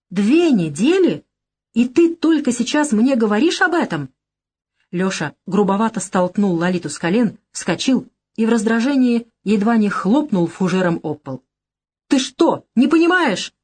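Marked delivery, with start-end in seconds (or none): 0.1-4.1 irritated disbelief. A narrator's reading. Lyosha learns she hid the knife two weeks, irritated. 12.1-13.5 high, loud exasperated snap; angry. A narrator's reading. Lyosha rages at Lolita over the knife, angry.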